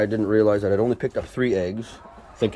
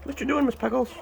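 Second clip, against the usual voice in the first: pet talk; 13 semitones higher than usual. Acted. Talking to a hen.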